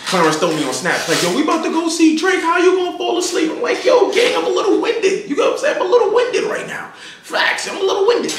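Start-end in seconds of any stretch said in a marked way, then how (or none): 1.2-3.5 high, quick mock disbelief; imitated incredulous friend; teasing call-out. Clarence teasing him on Snap. 3.8-8.3 very high, squeaky excuse; comic high-voiced self-defence; high-pitched protest. Acting his protest when caught dozing.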